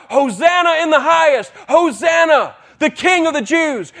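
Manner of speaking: high, loud joyful shout; crowd shouting. Retold or imitated. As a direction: cheer like a crowd hailing a king. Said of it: "they're crying out".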